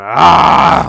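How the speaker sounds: very loud, high "aah" of exertion; imitated strained scream. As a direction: scream as if straining at a heavy load. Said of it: "He's screaming".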